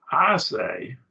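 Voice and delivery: "I say" with I pitched high; emphatic I. Shown as a demonstration. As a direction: hit I high and firmly. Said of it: "It's emphatic".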